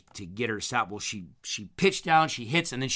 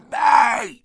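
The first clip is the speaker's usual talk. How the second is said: full-lung yell, much higher and louder; yelling the dog's name; 12 dB louder than usual. Retold or imitated. Owner yelling across the pasture at his dog, full-volume yell. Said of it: "And I just yell".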